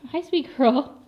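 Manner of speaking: high, sweet greeting to a pet. Real, not acted. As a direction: coo at the goat.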